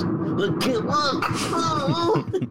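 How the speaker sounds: high, drawn-out mock squeal; hammed-up scared moviegoer. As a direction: squeal it slowly in comic terror. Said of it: "hands covering my eyes".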